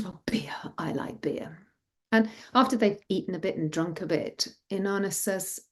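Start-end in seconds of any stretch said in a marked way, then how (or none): 0.0-1.6 soft, husky relish; beer-loving father. A narrator's reading. Father choosing his drink, quietly relishing. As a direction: savour it under the breath.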